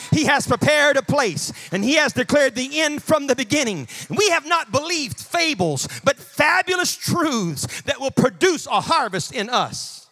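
forceful, punched sermon declarations; emphatic preaching. Real, not acted. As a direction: preach it with ringing conviction.

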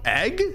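"egg" with a short e, high and doubtful; distasteful test of short-e "egg"; short-e pronunciation. Shown as a demonstration. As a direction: question "egg" with the vowel of "edge". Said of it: "That sounds so".

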